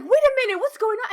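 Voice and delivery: high, loud, startled cry; alarmed. Retold or imitated. Player startled when Velen attacks, high cry. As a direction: caught off guard mid-fight, voice jumping up. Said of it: "I'm like".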